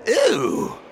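mock grossed-out ew; disgusted ew. Preacher mimics the crowd's disgust, mock ew. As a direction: pull a face and go ew. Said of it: "That's what they said".

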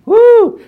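high, loud giddy whoop; a tipsy lovestruck cry; mock swoon woo. Preacher imitating a bride drunk with love, a giddy whoop.

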